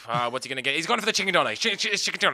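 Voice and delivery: mock commentator voice; mock sports commentary. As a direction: narrate Simon's kebab order like a live race. Mock commentary on kebab shop.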